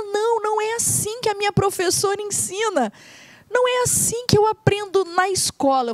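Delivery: put-on mild child voice; high child protest.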